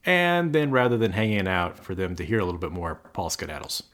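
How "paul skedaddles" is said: usual speaking voice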